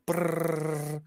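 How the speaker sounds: rolled-lip drum roll. Pretend. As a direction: do a mouth drum roll like Michael Scott. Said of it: "I could do the Michael Scott drum roll".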